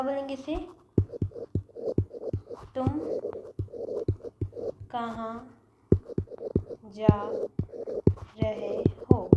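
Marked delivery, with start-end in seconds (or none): 2.7-9.3 teacher dictating the Hindi meaning word by word. Shown as a demonstration. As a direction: dictate each word separately. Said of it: "ये है सही structure".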